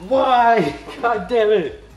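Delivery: high, loud panicked yelling at the burner; frantic high screams; panicked shrieks. Real, not acted. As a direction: shriek in real alarm as the cheese burns. Cheese burning on the burner.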